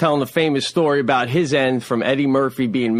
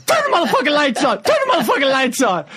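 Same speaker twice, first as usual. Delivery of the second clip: high, fast mock command; hammed-up, high-pitched shouted order; host shouting orders; 13 semitones higher, faster than usual. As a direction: holler it to the whole club as the host.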